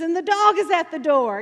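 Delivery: high, loud preaching shout; frenzied preacher. Retold or imitated. Preacher spouting random things to cheering church, frenzied call. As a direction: imitate a preacher crying out nonsense with fervor. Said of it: "getting all in this frenzy".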